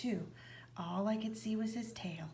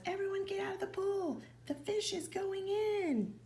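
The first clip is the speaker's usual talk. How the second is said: much higher, slowing at the end; yelled; 12 semitones higher than usual. A narrator's reading. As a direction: call out like clearing a pool. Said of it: "The fireman yelled".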